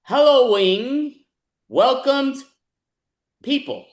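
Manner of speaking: loud announcing. Acted. Announcing the prompt.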